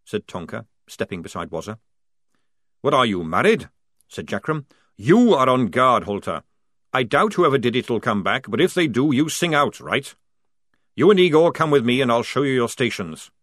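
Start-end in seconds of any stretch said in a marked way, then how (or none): 2.8-13.3 loud, higher barking sergeant; bellowing sergeant. A narrator's reading. Jackram ordering the squad, loud sergeant bark.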